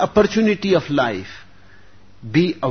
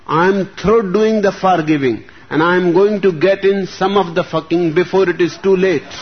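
loud, fed-up Mother Superior; stern nun; 5 semitones higher, 5 dB louder than usual. A narrator's reading. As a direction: bellow it, done with forgiving. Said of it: "says the Mother Superior grimly".

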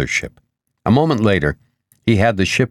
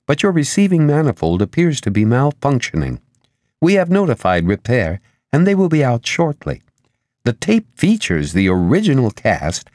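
higher, singsong official recital; lightly voiced operator; 6 semitones higher than usual. A narrator's reading. Ship's operator circuit reporting to Asher, lightly officious. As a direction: reel off the routine message, pitch lilting. Ship operator reads routine message.

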